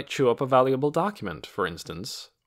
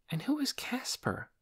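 1 wondering; 14 semitones higher, 7 dB quieter than usual. A narrator's reading. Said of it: "she wondered".

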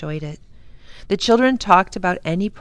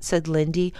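usual speaking voice

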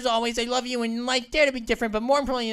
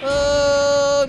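loud alarm; 2 semitones higher, 8 dB louder than usual. Real, not acted.

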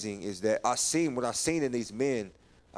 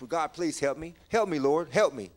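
raised, louder plea to God; pleading prayer; 4 semitones higher, 5 dB louder than usual. Retold or imitated. Man begging God to take him out, pleading. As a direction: cry out for help, re-enacting desperation. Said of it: "I asked God to take me out".

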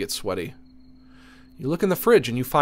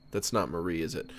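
ordinary talk

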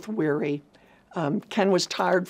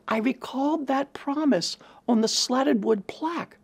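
normal talking voice

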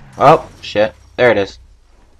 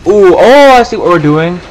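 excited; 6 semitones higher, 6 dB louder than usual. Real, not acted. Realizes the plan, loud.

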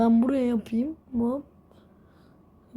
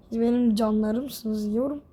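ordinary delivery

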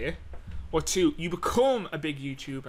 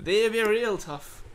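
put-on Irish accent; 6 semitones higher, 4 dB louder, slower than usual. Repeating the Irish line.

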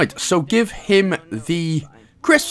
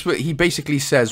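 ordinary talk

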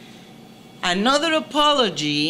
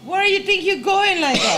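laughing tease; 10 semitones higher, 3 dB louder than usual. Real, not acted. Teasing his outfit.

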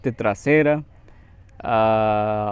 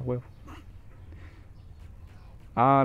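ordinary delivery

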